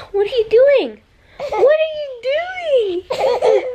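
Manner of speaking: laughing. Real, not acted. Mom laughs at her son's silliness, high and giggly. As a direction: giggle the question at him.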